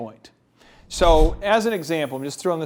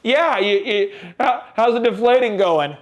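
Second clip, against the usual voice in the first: raised, louder taunting fan voice; mock heckling; 4 semitones higher, 4 dB louder than usual. Strangers teasing a Brady fan, mock heckling. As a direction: needle him like a stranger spotting the jersey.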